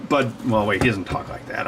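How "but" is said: ordinary delivery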